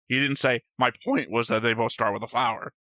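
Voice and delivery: ordinary talk, nothing marked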